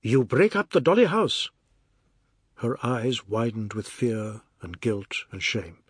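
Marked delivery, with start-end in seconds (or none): 0.0-1.5 angry accusation. Acted. Husband accusing his wife.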